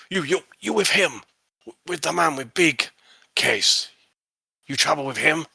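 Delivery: high, halting broken-English questioning; foreign customs officer. Acted. Chinese customs officer questions Bert about Sir Edward, high and halting. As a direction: interrogate in clipped broken English. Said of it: "he says, uh".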